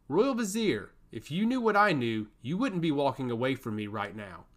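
ordinary delivery, nothing marked

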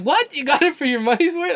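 high, loud mock outrage, imitating the shock; shocked disbelief. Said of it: "It's like".

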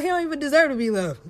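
high, loud, fast and fed up; mock exasperated outburst. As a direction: blurt it out in mock anger. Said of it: "tell me how you really feel".

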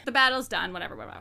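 ordinary delivery

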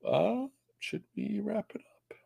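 hushed whisper, slow. Real, not acted. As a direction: lean in and whisper the question. Ending stream, hushed aside.

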